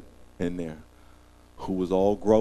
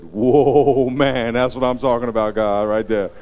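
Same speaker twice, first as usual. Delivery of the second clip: amazed Adam; 3 semitones higher, 8 dB louder, slower than usual. Retold or imitated. Adam seeing Eve.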